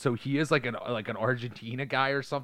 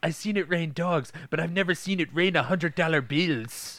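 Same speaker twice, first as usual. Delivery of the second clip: mock Spanish accent; 7 semitones higher than usual. Quoting a badly cast movie character, mock Spanish accent. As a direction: quote the bad actor in a hammy Spanish accent. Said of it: "a terrible accent".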